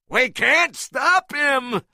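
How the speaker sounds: high, loud panicked yell; shouted. A narrator's reading. A sailor warning the others, a high panicked shout. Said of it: "shouted one of the sailors".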